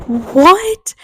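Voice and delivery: a high, rising what; imitating the family's disbelief in the car; mock shocked what. The family in the car reacts to the burning house, high shocked what. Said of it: "we were like".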